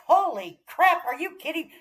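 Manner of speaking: retold shocked exclamation. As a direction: gasp it out in disbelief. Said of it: "I was like".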